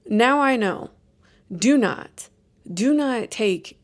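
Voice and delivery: normal talking voice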